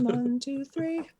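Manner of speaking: very high, playful falsetto count; high-pitched count. Pretend.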